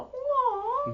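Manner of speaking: high mock whimper; mild mock sadness; mock hurt "Aw". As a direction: pretend to be hurt.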